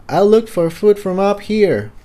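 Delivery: put-on high, light young filly voice; Tiptoe's voice. Young pegasus calling down to Jigsaw, high voice.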